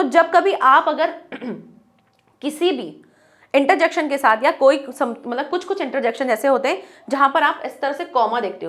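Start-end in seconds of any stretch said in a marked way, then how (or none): none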